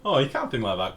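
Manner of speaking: normal talking voice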